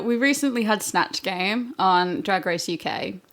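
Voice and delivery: ordinary talk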